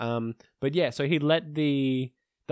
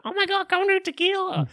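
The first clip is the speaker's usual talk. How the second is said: much higher excited urging; high-pitched hype girl. Retold or imitated. Stranger in town urging Tim to do tequila, high excited voice. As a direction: egg him on like a stranger at a bar.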